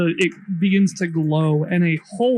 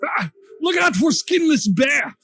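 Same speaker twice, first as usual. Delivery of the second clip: shouted warning; 7 semitones higher than usual. Acted. Warning about the bear.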